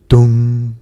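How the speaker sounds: loud, booming "dong" held on one note; clock chime. A narrator's reading. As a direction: imitate a striking clock, ringing out the hum. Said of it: "went the clock again".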